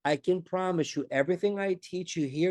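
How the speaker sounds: normal talking voice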